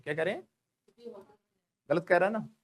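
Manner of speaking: normal talking voice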